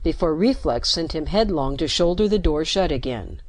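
ordinary delivery, nothing marked

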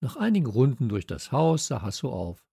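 plain reading voice

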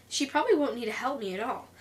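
ordinary delivery

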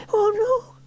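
high frightened cry; alarmed cry. A narrator's reading. Sarah crashing into a hedge, crying out. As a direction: yelp as the bike crashes. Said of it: "cried Sarah".

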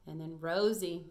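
ordinary talk, nothing marked

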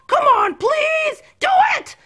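high, drawn-out plea; insistent begging. Acted. Patient begging the reluctant doctor, insistent.

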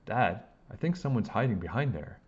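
usual speaking voice

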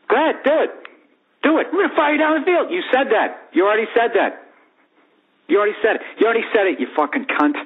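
high, mocking dare; cocky taunt. Taunting an angry husband who threatens him, high and mocking. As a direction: goad him into backing down.

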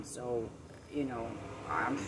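ordinary delivery

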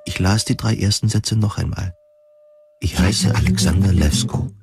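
usual speaking voice

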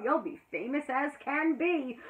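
usual speaking voice

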